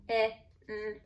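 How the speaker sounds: "k-i-n" as separate letter sounds; sounding out. Shown as a demonstration. Teacher checking the second syllable, sounding out. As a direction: give each sound of "k-i-n" its own beat. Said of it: "you wanna spell by syllable".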